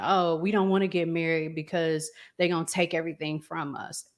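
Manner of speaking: ordinary talk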